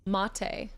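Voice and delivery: Mate in two syllables, ending "ay"; rhymes with latte. Shown as a demonstration. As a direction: say Mate as MAH-tay. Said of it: "pronounced like latte".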